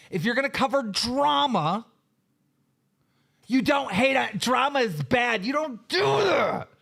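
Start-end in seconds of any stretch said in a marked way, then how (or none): 5.4-6.7 high, slow, shouted scolding; angry shout. Real, not acted. Streamer scolding Rich for hating drama, slow angry shout. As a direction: bark the rule at him, slowly.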